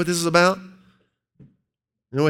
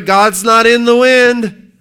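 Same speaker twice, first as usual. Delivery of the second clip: loud, slow, high proclamation; preaching emphasis; 7 semitones higher, 11 dB louder, slower than usual. Real, not acted. Preacher concluding from Job, loud and slow. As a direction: punch the point home.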